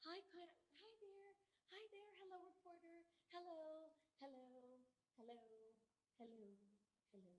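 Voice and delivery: timid hellos to reporters walking right past; awkward greeting. Retold or imitated. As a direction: trail off as nobody answers. Greeting reporters who ignore her.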